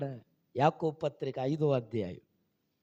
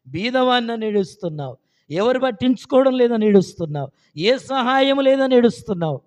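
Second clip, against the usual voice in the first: loud, rising list of griefs; mounting pulpit force; building sermon litany; 9 semitones higher, 12 dB louder than usual. Real, not acted. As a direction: drive each item higher and harder.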